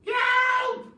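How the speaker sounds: very high, loud shrieking cry for help; full-throated shriek of fright; panicked scream. Acted. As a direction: shriek like a terrified old hag. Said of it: "screamed Missus Twit".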